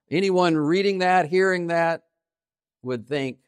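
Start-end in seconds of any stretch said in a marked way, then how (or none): none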